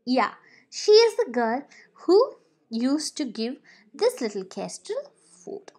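ordinary delivery

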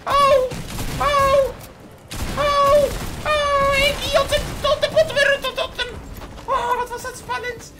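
very high, loud breathless yelps; thrilled screams. Real, not acted. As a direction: scream with tension. Tense escape, screaming.

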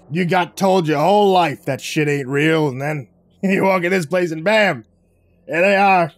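chummy and amused; hearty guy voice. Acted. Vic voice, hearty.

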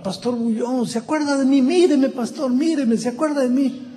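high, pleading call from a crowd; imitating desperate woman. Woman calls out to the pastor from the crowd, high desperate cry. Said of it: "una señora en medio de la multitud gritaba".

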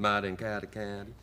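Southern accent swallowing the syllables into "Mud in Carter County"; thick Southern accent. Shown as a demonstration. Actor repeating the title for a baffled host, thick Southern accent. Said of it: "Nobody understands me".

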